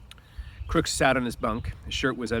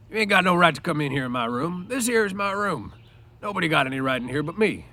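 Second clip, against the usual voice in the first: sharp, higher, louder warning; sharp Crooks; 7 semitones higher, 4 dB louder than usual. A narrator's reading. Crooks sharply ordering Lenny out of his room. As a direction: snap at the intruder to get out. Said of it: "Crooks said sharply".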